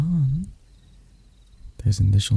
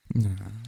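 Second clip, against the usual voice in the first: brief, self-satisfied chuckle; knowing chuckle; 7 dB quieter than usual. Acted. Demon confirming a suspicion, knowing chuckle. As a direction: chuckle as if proved right. Demon amused by captured hunter.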